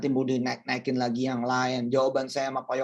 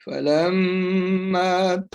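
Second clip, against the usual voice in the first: Quran recitation. Shown as a demonstration. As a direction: chant the verse.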